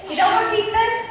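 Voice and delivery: mocking jab. Beatrice's parting jab at Benedick.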